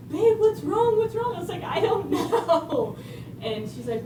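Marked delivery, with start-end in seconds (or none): 0.1-1.4 much higher, louder worried woman's voice; woman rushing to comfort a crying stranger; concerned woman. Retold or imitated. 1.6-3.0 slow, high, laughing through the words; giggling helplessly when asked what's wrong. Retold or imitated.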